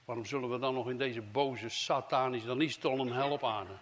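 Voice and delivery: ordinary talk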